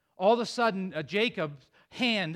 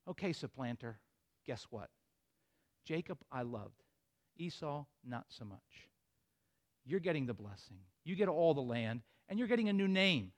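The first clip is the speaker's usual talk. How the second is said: low, soft, hushed voice of God; God quietly assuring Jacob of blessing, tender; tender divine voice; 7 semitones lower, 9 dB quieter than usual. Acted. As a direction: speak gently as God blessing him.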